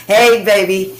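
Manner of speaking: ordinary delivery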